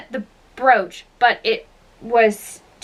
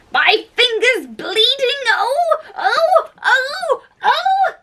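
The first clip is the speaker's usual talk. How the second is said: very high, wailing pain cries; screaming; 13 semitones higher, slower than usual. A narrator's reading. Queen shaking her bleeding finger, shrieking very high. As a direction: squeal it like a steam-engine whistle. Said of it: "Her screams were ex".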